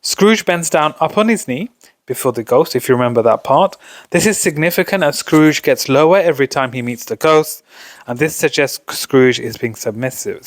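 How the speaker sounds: normal talking voice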